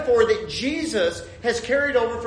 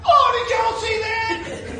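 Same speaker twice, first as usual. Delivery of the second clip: high, drawn-out excited shout; excited exclamation; 4 dB louder, slower than usual. Retold or imitated. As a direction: call out in amazement to your hunting buddies. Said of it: "and I went".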